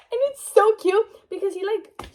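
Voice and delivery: squealing laugh, pitch way up. Real, not acted. Fan gushing to viewers about the hero, laughing squeal. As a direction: break into a giddy laughing shriek. Gushing over a romance scene.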